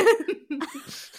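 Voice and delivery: high-pitched burst of laughter; loud, high giggle; laughing. Real, not acted. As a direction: crack up at your own joke. Laughs at own joke.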